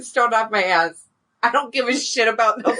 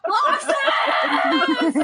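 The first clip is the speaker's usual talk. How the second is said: shouted name; 13 semitones higher than usual. Acted. Calling out across the beach.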